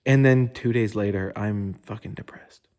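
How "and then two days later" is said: ordinary talk, nothing marked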